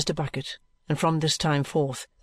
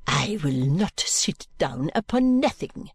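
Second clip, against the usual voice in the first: defiant French maid; slower than usual. Acted. Mademoiselle's held voice.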